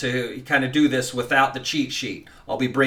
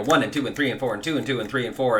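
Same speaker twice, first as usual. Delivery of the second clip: fast, running count; rushed count; much faster than usual. Shown as a demonstration. Teacher showing a count that is too fast.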